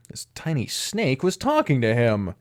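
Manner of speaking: plain reading voice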